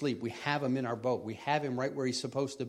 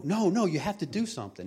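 higher-pitched urgent pleading; frantic protest; 6 semitones higher, 4 dB louder than usual. Acted. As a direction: plead for action, acted out. Acted frantic protest to Jesus.

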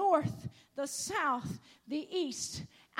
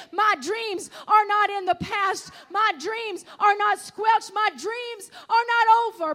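louder and louder, climbing into a shouted proclamation; full-force preaching; 4 dB louder than usual. Acted. Preacher to the congregation, at full force. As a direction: preach it to the rafters, building.

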